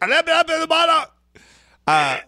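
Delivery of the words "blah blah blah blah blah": much higher, loud mock gibberish; shouted translator babble. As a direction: yell the nonsense like a frantic interpreter. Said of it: "reenact the end of Rocky four".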